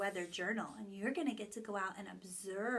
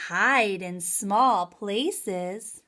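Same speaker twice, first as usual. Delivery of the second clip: lively, expressive picture-book reading; reading to children; 8 dB louder than usual. Acted. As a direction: read aloud with big storytelling energy. Reads story to children.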